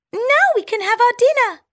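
high squeaky mouse, quick and cheerful; town mouse voice. Acted. Town mouse urging her friend to eat, fast high squeak. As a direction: rush on to dinner.

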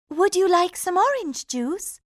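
high sweet princess; falsely sweet offer; cartoon princess. A narrator's reading. Princess voiced.

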